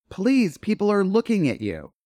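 a light touch of the sweet Rose Nyland; sweet Rose line. Retold or imitated. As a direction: scold her kindly as the sweetest woman alive. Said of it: "I am playing America's sweetheart".

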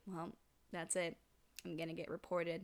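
low, soft resigned quote; subdued acted resignation. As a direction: retell it quietly, as one giving up. Retold resigned hero facing the end.

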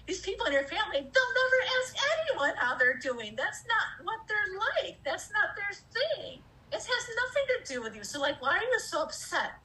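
mock the self for caring, high and sneering; inner critic. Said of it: "part of me that's just like".

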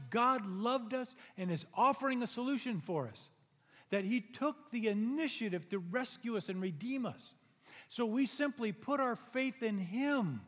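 loud, high preaching climax; loud, high pulpit preaching; preaching at full force. Acted. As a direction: preach it with rising fire.

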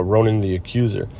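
ordinary talk, nothing marked

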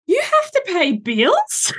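put-on very high, slow, incredulous kid; put-on child's voice, stretched-out disbelief; seven-year-old son. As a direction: imitate a little boy astonished by the news. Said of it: "Like, he's seven".

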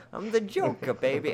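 very high, loud cartoonish Joker; hammed-up squeaky Joker shout; Heath Ledger Joker. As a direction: belt it out as a bad confident Joker. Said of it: "Heath Ledger Joker".

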